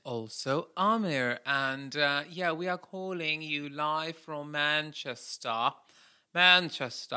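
slow, exaggerated put-on Manchester accent; hammed-up Manchester vowels; mock Manchester accent. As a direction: lay on a drawn-out fake Manchester accent. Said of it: "people in Manchester speak like this".

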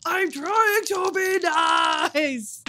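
shouted through laughter, slow; laughing. Real, not acted. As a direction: crack up mid-line. Player laughing after intimidating.

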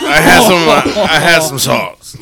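loud slurred parody, restarting the phrase; boozy mock impression; drunk Bill Cosby. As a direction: stumble through it as a hammed-up drunk. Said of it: "Took one of his own drinks".

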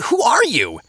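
high-pitched exasperated question; incredulous. Acted. Traveler demanding the lazy stranger's name, incredulous. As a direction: burst out in disbelief.

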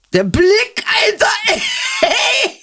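sudden high yelp of disbelief; shocked outcry. Real, not acted. Reacting to the chosen text, a high shocked shout. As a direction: cry out in shock.

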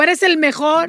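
mock gushing, put-on voice; wife faking praise to her husband; faked praise. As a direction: fake it sweetly to flatter him. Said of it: "le digo".

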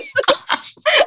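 high nervous giggle burst; a nervous laugh without words. Real, not acted.

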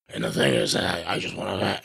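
low, failed-whisper impression; mock strained whisper, words half swallowed; Vince. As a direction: try to whisper but let a low grumble out. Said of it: "he's trying to whisper".

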